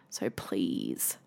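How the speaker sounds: soft, whispered plea; a whispered please to anyone struggling; pleading whisper. Real, not acted. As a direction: beg them gently under your breath. Earnest plea to struggling listeners.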